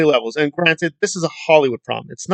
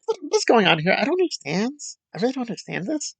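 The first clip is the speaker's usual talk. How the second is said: high, soft, breathy mock bewilderment; mock confusion; 6 semitones higher, 7 dB quieter than usual. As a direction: fake helplessness, voice pitched up and fading. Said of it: "It's like".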